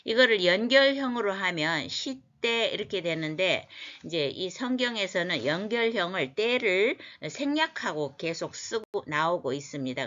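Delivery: ordinary talk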